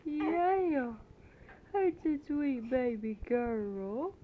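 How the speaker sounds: high, loud sing-song cooing to a crying infant. Real, not acted. Mother soothing her crying baby daughter on tape, loud high baby talk.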